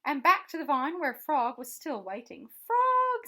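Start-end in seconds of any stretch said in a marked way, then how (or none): 2.7-3.3 high, loud call. Acted. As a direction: call his name joyfully. Calling friend from the air.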